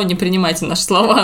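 ordinary talk

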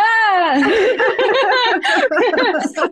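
high, nervous burst of laughter. Real, not acted. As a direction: laugh nervously, caught off guard. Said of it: "Это нервный смех". (нервный смех)

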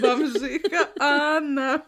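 slow, giggling high; laughing. Real, not acted. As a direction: say it through laughter. Laughing at the name.

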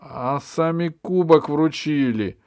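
loud, high, slow bitterness; drawn-out bitter protest; bitter reproach. A narrator's reading. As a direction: throw it back at them, wounded and accusing. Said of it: "сказал с горечью Пашка".

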